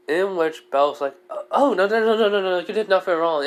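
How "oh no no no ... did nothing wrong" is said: high, loud flustered no no no; flustered denial. Acted. Belle assuring her she did nothing wrong, flustered and high. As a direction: rush to deny it, higher and louder.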